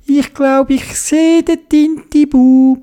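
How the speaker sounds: high-pitched figure voice; Theobald's underwater voice. A narrator's reading. Theobald spotting the lost squid in the distance, high excited voice. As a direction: pitch it high and call out the sighting.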